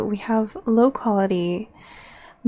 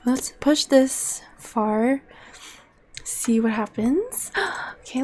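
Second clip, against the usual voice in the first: ordinary delivery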